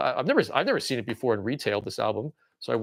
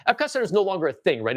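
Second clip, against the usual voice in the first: ordinary talk, nothing marked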